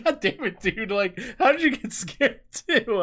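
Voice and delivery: high-pitched imitation of an exasperated friend; mock exasperation. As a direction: play the friend's baffled complaint. Acts out friend's exasperated reaction.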